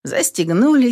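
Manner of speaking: plain reading voice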